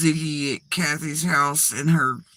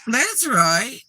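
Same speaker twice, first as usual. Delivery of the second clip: imitated tone change; 5 semitones higher than usual. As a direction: echo it with emphatic eagerness. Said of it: "But listen to that tone again".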